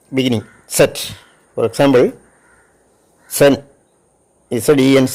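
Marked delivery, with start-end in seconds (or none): 0.8-0.9 "Z" pronounced tset, the German way; T sound first. Shown as a demonstration. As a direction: start "Z" with a ts. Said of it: "pronounced with a T sound". ("Z" ട്സെറ്റ് എന്ന ഉച്ചാരണത്തിൽ)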